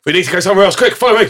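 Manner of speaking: high, loud, frantic mock panic; hurried high-pitched urging; urgent festival-goer. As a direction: act out a restless raver rallying mates. Acting restless festival crowd.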